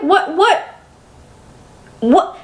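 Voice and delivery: ordinary talk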